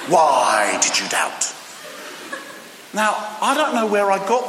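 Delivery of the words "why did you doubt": slow, low, stretched-out angry rebuke; hammed-up divine reproach, dropping low; mock angry voice. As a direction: draw out WHY like a stern, angry God. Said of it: "we give God an angry voice".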